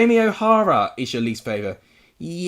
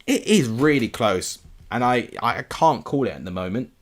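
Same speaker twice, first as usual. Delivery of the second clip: usual speaking voice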